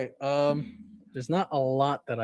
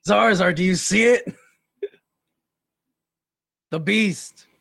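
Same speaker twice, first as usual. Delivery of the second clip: calling out; 7 semitones higher, 8 dB louder than usual. Acted. Calling out to character.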